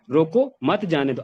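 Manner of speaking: full stop after "रोको", then "मत जाने दो"; "रोको" alone, break, "मत जाने दो" as one phrase; pause after रोको. Shown as a demonstration. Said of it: "रोको के बाद फुल स्टॉप लगाया".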